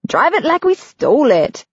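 high, wild urging; reckless cheer. A narrator's reading. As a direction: egg her on recklessly.

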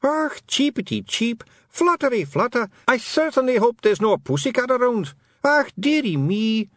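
high, put-on Scots feagle yelp; mock bird yelling. Feagle hoping there's no pussycat around, high mock-pitiful yelling. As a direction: cry out in a high pitch like a pretend helpless baby bird. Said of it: "it yelled".

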